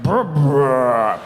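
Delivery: low, loud burp voice; deep burp voice. Acted. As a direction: voice the body saying burp. Body voiced burping.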